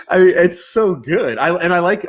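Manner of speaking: high and loud through laughter; delighted high voice, fan of mint chip; excited, laughing. Real, not acted. Excited about mint ice cream.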